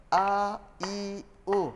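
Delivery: A, I, U each on its own beat; one tap per letter. Shown as a demonstration. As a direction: model A, I, U for the class. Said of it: "Ustaz buat dulu". (satu ketukan setiap huruf)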